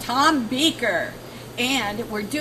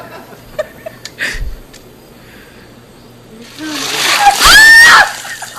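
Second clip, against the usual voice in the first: shrieking laugh from the icy shock; 13 dB louder than usual. Real, not acted. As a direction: squeal and laugh as cold water hits. Ice water poured over her.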